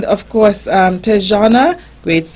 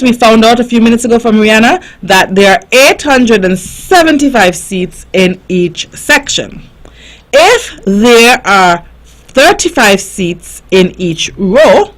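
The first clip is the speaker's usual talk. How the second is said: ordinary talk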